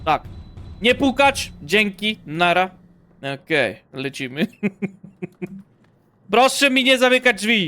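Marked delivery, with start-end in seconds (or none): 0.8-2.7 loud, raised-pitch dismissal; brusque shout. Pretend. Player sending away whoever knocks, brusque shout. 6.3-7.7 loud, high-pitched telling-off; indignant shout. Pretend. Player scolding whoever keeps shutting doors, indignant shout.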